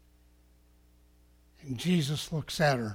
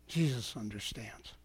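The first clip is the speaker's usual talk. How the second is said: low, very soft comfort; hushed reassurance; 5 semitones lower, 11 dB quieter than usual. Real, not acted. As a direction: drop right down to reassure them. Reassurance after the loud build.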